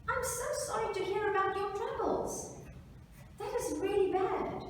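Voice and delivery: high-pitched put-on sympathy; sympathetic pig. Pig hears the mouse's troubles, high and sympathetic.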